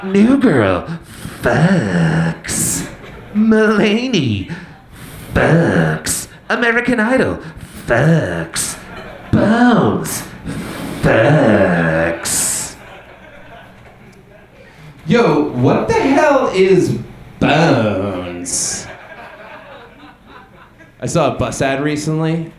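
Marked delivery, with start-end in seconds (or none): 0.0-12.8 mock network promo, each name separated; TV promo announcer. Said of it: "every commercial is just like". 15.1-18.9 imitating a dramatic network voice-over; mock announcer. Echoing the promo announcer's voice.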